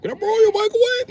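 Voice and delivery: very high pleading whine; high mocking beggar voice; squeaky crackhead voice. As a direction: beg for it like a crackhead. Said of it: "Crackheads ask you".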